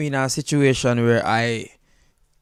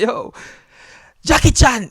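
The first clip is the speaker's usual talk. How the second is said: calling out; 6 semitones higher, 4 dB louder than usual. Retold or imitated. Calls Japanese friend Jackie Chan.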